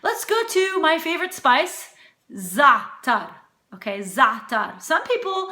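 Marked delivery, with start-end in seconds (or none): 2.3-4.8 za'atar as ZAH-tar, first syllable stressed; correct pronunciation. Shown as a demonstration. Cook teaching her favorite spice, properly pronounced. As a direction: punch the first half of za'atar. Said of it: "it's za'atar".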